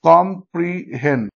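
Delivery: "কম্প্রিহেন" slowly, in three separate pieces; split into syllables. Shown as a demonstration. Teacher giving an anapest example, slow and syllable by syllable. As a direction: sound out "কম্প্রিহেন" beat by beat. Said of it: "stressed syllable".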